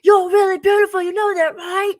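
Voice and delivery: high, loud mock British accent. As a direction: imitate a British man screaming compliments in someone's ear. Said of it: "he had this British accent".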